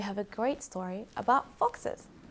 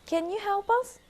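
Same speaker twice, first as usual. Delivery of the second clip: fox asking; 12 semitones higher than usual. Acted. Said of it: "asked fox number two".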